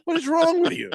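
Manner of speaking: mock exasperation, pitched high; exasperated rant. Mock outburst at team owner.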